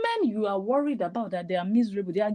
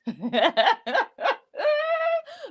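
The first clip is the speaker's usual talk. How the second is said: shrill burst of mocking laughter; high mocking laugh. Real, not acted. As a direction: cackle at her, much higher. Mocking the guest's accent.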